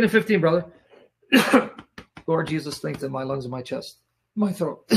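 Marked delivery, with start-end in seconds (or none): 2.3-4.8 low, hushed aside to the Lord; murmured prayer. Real, not acted. Preacher asks Jesus to strengthen his lungs, murmured prayer. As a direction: pray quietly under your breath.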